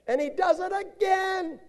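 exasperated. Acted. Preacher exasperated the man sinned again, high outburst. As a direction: throw up your hands at him.

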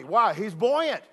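normal talking voice

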